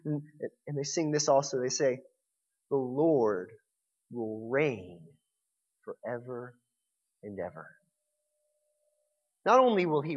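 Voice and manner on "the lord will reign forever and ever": softer and lower, slow and fading; reverent hush. Acted. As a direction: let it fade in awe. Quoting the song reverently.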